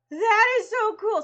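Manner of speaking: high, loud, excited praise; thrilled and wowed; bursting with enthusiasm; impressed. Retold or imitated. Quoting the impressed actor.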